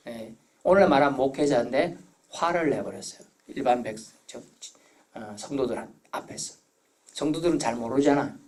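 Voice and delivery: usual speaking voice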